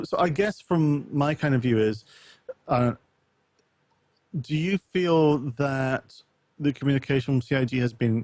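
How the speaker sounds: ordinary talk, nothing marked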